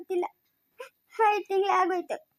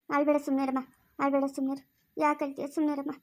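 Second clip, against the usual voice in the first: low, soft hushing; soothing; 5 semitones lower, 4 dB quieter than usual. Acted. Confidante hushes the crying mother, soothing. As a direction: gently hush someone who is crying.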